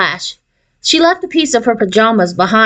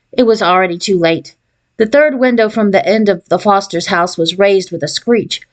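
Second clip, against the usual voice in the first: ordinary delivery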